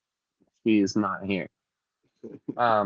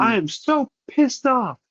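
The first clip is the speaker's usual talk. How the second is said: angry yell; 12 semitones higher than usual. Retold or imitated. Owner furious at the cat, high drawn-out angry yell. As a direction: draw it out in a fury. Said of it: "I was yelling".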